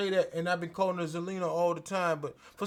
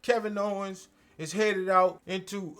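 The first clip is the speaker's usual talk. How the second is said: ordinary delivery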